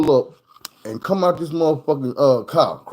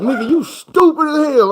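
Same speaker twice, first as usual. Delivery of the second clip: much higher, hammed-up disbelief; mock friend's scolding; 13 semitones higher, 4 dB louder than usual. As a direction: scold him like a stunned buddy.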